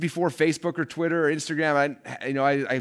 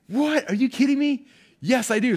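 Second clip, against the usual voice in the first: much higher, louder, overjoyed "What?"; thrilled disbelief; 12 semitones higher, 4 dB louder than usual. Retold or imitated. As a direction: burst out in stunned delight. Thrilled at second call offer.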